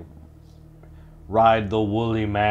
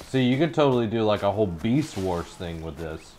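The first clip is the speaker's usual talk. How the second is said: ordinary delivery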